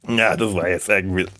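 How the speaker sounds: strained voice between coughs; choking on a drink. Real, not acted. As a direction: speak while still choking. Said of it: "ich hab mich total verschluckt".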